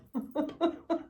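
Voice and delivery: short embarrassed giggle; embarrassed laugh. Real, not acted. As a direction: laugh it off after choking. Laughs off her choking. (petit rire gêné)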